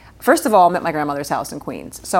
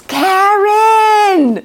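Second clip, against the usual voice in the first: long high shriek of Karen, much louder; 8 dB louder than usual. Retold or imitated. Actress recalling Goodfellas, shrieking the name Karen. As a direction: wail the name like a frantic Goodfellas scene. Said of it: "all I could think in my mind was".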